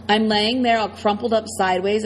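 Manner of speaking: ordinary delivery, nothing marked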